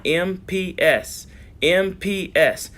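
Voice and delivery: each letter spelled out slowly; slow dictation. Shown as a demonstration. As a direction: spell it slowly so they can write it down. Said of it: "Write that down".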